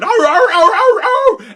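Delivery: high loud yelping dog howls, half laughing; dogs howling. Retold or imitated. Imitating the dogs howling at the fox, high and loud. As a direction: yelp like frustrated dogs at the fence line. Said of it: "the dogs would run ... going".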